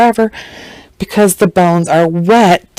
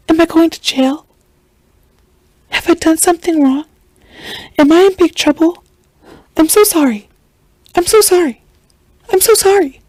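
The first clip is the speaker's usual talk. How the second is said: mock pleading in a much higher voice; imitated pleading suspect; 10 semitones higher than usual. Suspect keeps asking officers about jail, imitated high pleading. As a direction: put on a high, pleading little voice for her.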